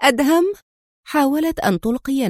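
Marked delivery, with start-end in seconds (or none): 0.0-0.5 higher, louder cry of surprised joy; joyful cry. A narrator's reading. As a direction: call out his name in astonished delight. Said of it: "وهي تهتف".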